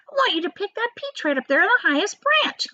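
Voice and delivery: put-on high, mean old aunt; the greedy aunt, pitched high; aunt's voice. Aunt Spiker eyeing the peach, high and greedy. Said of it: "Aunt Sponge went on".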